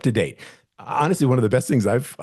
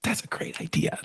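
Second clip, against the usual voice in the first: mock-conspiratorial whisper, much softer; whispered reply; 9 dB quieter than usual. Agent plays along with client's secret, whispered. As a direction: whisper your approval back.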